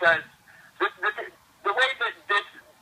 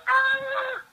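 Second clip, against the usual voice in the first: sudden high yelp of rage, imitating a friend; a friend slams on the brakes and lets out a high mock yell; angry yell. A friend hitting the brakes, a high mock yell. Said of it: "he's like".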